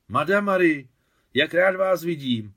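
delighted greeting. Acted. Joyful reunion with old friend.

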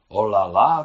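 hlasité, nadšené zvolání; vykřikne obdivem jako Francouzka. A narrator's reading. Guvernérka nadšená Mášenčinou francouzštinou. (guvernérka nadšeně vykřikne nad Mášenčinou francouzštinou)

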